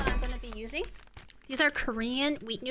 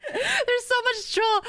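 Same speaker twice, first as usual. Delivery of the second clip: laughing; 10 semitones higher, 4 dB louder, slower than usual. Real, not acted. Laughing at the drooling dog.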